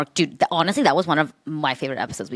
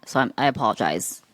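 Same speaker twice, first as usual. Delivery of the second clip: ordinary delivery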